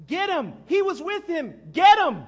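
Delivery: high, full-force shout of alarm; shouting guard. Acted. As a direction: yell to set the crowd on him. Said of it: "he starts shouting".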